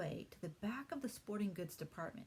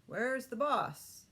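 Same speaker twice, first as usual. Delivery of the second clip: slow, louder booming question; 6 semitones higher, 8 dB louder, much slower than usual. Acted. Big man booms his question at the children, slow and loud. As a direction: boom it out across the store. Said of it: "he boomed".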